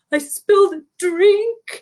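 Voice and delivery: high, slow tearful confession; mock sobbing. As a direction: whimper it out slowly, hammed up. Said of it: "I was sobbing so much".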